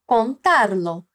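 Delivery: ordinary delivery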